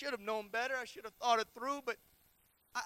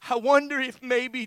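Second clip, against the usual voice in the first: loud, raised-pitch preaching; full-force preaching; 4 semitones higher, 15 dB louder than usual. Real, not acted. Preacher on a mother's eye contact, full-force preaching. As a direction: preach it loudly, drawing out the end.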